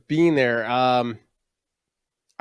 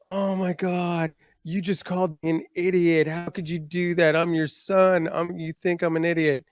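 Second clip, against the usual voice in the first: put-on whiny offended teen; 6 semitones higher than usual. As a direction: ham up the wounded son. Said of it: "I'd be like".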